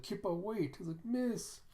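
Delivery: high, urgent call. Retold or imitated. A companion calling after Kippa: a high, urgent call.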